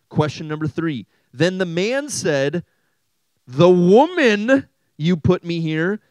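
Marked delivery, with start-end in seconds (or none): 3.5-6.0 loud, slow, high-pitched blaming; accusing blame. Acted. Adam blames the woman to God, loud and accusing. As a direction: draw out the accusation, loud and higher.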